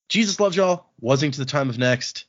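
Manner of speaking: ordinary talk, nothing marked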